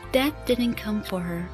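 normal talking voice

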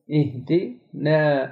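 इहदिना slow, the final aa thin and light; fine Quranic alif. Shown as a demonstration. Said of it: "हम ना नहीं कहेंगे". (नरम, बारीक अलिफ)

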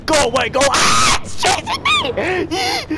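very high, loud terrified shrieks; panicked screaming. Real, not acted. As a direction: scream as it chases you down. Being chased by the monster.

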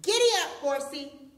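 loud, high rider's call; triumphant storybook holler; yelled. A narrator's reading. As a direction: yell it like spurring on a horse. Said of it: "and yelled".

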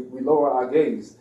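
ordinary delivery

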